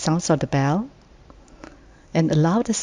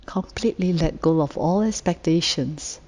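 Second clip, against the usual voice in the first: guided meditation; 4 dB quieter than usual. Real, not acted. Meditation leader inviting the group to settle and let go, calm and unhurried. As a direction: gently lead sitters into rest, phrase by phrase.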